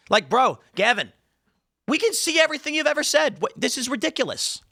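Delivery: high-pitched incredulous protest; exasperated disbelief. Real, not acted. Host scolding the governor, exasperated. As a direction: protest high, fed up with the nonsense.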